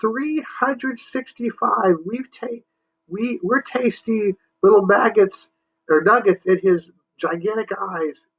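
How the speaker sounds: high, fast-tumbling alien voice; scared Marsman. Acted. Marsman panicking about the monster, high frightened babble. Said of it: "cried the Marsman".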